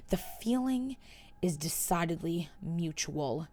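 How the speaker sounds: quiet, slow and contemptuous; cool scorn, drawn out; disdainful. Acted. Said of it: "an expression of disdain".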